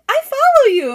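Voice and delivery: high, loud mock fan squeal; starstruck fan. Parent tells her she follows, loud high excitement. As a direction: blurt it like a thrilled follower.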